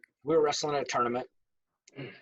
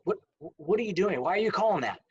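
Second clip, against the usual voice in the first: higher-pitched, mildly indignant challenge; raised pitch, acted protest to an official; retold coach's protest; 6 semitones higher than usual. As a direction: imitate a coach disputing a referee's call. Coach storms over to challenge official.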